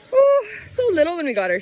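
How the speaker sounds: quick, high and loud; fond excited gush. Real, not acted. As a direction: gush fondly. Fond memory of calf.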